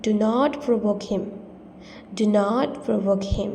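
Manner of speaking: slow and clear; slow model sentence. Shown as a demonstration. Teacher modelling the sentence slowly. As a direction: say it slowly for learners. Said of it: "তাকে উত্তেজিত করবে না".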